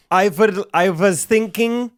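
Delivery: high, slow, put-on German accent; mock German accent. Imitating the German student in a slow, high German accent. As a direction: ham up a German teenager. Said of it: "can you give me a German accent".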